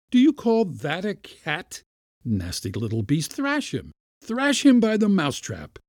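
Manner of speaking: high-pitched indignant scolding; angry bawling. A narrator's reading. Uncle ordering the kitten thrashed, bawling angrily. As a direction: rail at the useless animal. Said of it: "bawled Pyotr Demyanitch".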